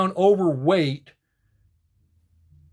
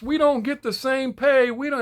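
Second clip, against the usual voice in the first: mock whine; 7 semitones higher than usual. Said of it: "and I'm not mocking you".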